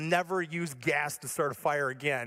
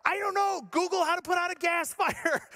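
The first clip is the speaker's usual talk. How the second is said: high, acted panicky outburst; hammed-up panic, pitched way up; frantic reply; 11 semitones higher than usual. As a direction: replay a flustered husband at a loss. Acted panicked answer to wife.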